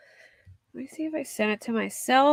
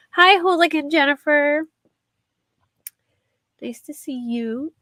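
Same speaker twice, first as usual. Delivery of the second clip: mock sweet greeting; 7 semitones higher, 6 dB louder than usual. Real, not acted. Mocking greeting to a critic.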